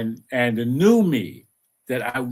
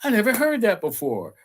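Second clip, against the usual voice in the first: high, wide-eyed wonder at a new discovery; amazed exclamation; 9 semitones higher than usual. Retold or imitated. Recalling first hearing the inner voice, amazed. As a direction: gasp it out like a revelation. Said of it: "I was like".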